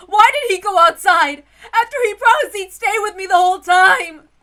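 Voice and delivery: high, loud, frantic wailing; hysterical panic. Acted. Ava warning everyone about the witch, hysterical. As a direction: beg them in terror. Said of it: "make Ava's emotions explode".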